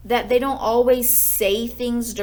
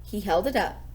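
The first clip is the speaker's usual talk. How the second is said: ordinary delivery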